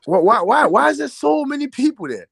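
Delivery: can't believe the crowd, asking it out loud; rising astonished why; amazed disbelief. Real, not acted. Amazed at crowded festival footage.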